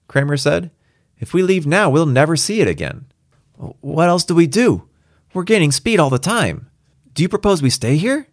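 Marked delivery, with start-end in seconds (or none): 1.2-8.3 high, urgent voices of crewmen arguing; agitated argument. Acted. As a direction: press the point, pitched well up. Characters argue over jumping.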